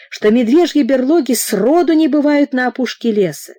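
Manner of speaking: ordinary delivery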